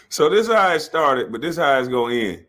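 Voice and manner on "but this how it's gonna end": usual speaking voice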